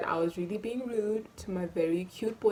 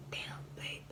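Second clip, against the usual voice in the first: soft, unvoiced awed whisper; imitating a scared boyfriend whispering; 12 dB quieter than usual. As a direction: hush it like you're afraid. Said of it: "he was scared".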